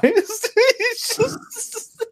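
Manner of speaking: high wheezing laughter into words; cracking up high before speaking; laughing. Real, not acted. Friend wheezes with laughter at the Stuckmanized bit.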